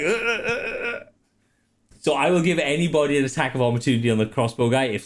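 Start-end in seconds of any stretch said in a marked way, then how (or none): 0.0-1.0 high frightened stammer; panicked stammer. Acted. Crossbow guy stammering in fear as he runs. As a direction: stutter in high-pitched panic. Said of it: "and he's like".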